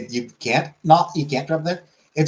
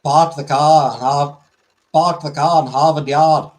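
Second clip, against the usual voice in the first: louder, fuller Boston accent; 3 semitones higher, 4 dB louder than usual. Shown as a demonstration. Streamer retrying the famous Boston phrase, louder Boston accent. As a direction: lay on the Boston accent, no R's. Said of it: "for the Boston accent is".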